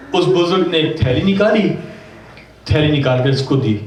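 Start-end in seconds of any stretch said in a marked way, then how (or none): none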